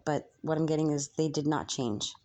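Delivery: normal talking voice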